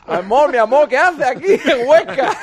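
laughing put-on Caribbean Spanish lilt; caribbean spanish accent. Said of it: "tienen hasta acento caribeño".

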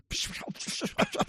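a high, breathless burst of laughter. Real, not acted. Retelling the mili story to friends, high breathless laugh.

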